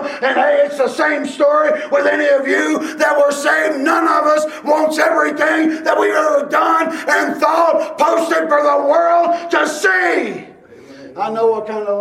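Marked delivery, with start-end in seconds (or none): 0.0-10.5 high, loud pulpit shouting, slowing at the end; full-force revival preaching; fiery preaching. Acted. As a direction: preach it out to the whole congregation.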